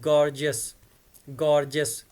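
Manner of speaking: gorgeous as two syllables, "gor-jus", no "ee-us" ending; correct pronunciation. Shown as a demonstration. Teacher correcting students: gorgeous said right as "GOR-jus". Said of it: "it is".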